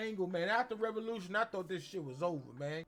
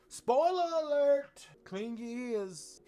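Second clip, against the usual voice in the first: mock warning; 5 semitones higher, slower than usual. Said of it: "spoiler warning".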